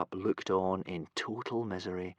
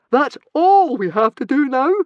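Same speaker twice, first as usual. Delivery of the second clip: far higher, loud incredulous squeal; shrieking panicked question; 13 dB louder than usual. Acted. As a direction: squeal it in disbelief. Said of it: "squealed Fishlegs".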